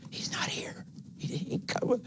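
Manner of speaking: unvoiced whisper at the graveside; whispering mourners. Mourners at Lazarus's tomb whisper, hushed and unvoiced. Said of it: "heard was whispering".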